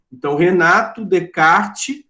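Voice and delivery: ordinary talk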